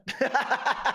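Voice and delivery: high peals of laughter. Real, not acted. Hearty laughter.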